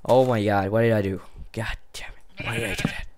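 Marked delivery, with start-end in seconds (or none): 1.5-3.1 breathy whisper under the breath; whispered curse. Real, not acted.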